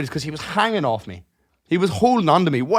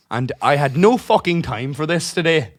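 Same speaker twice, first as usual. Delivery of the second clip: ordinary talk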